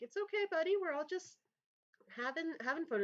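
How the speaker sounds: high, light soothing voice; placating buddy voice. Acted. Raleigh calming annoyed Mikhail, high and soothing. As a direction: pitch up to calm a grumpy friend. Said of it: "It's like".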